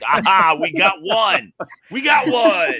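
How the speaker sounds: loud, high gleeful crowing; exultant shout, rising in pitch; mock triumph. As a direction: crow it like you've just won a convert. Retold triumph at winning a convert.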